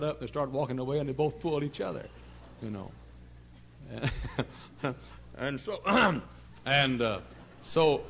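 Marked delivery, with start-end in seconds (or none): 3.0-5.3 airy laugh. Real, not acted. Laughing at hair prank.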